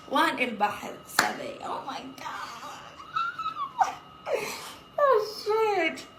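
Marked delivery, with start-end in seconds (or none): 1.6-6.1 very high, slow laughing shriek. Real, not acted.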